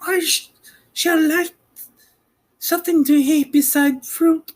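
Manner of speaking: put-on high young girl voice, curious; Dorothy's girl voice. Girl asking about Oz, high and curious.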